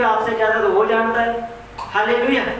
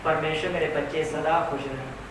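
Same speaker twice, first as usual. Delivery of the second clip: softer, a quiet blessing to himself; inner blessing; 6 semitones lower, 8 dB quieter than usual. Retold or imitated. Tired father blessing his children inwardly, softly. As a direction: bless the children under your breath. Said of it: "वो नहीं अंदर से बोलेगा".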